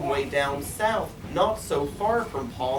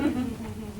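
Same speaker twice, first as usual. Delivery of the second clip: giggle; 6 semitones higher, 6 dB quieter than usual. A narrator's reading. Maisie laughs at Horton.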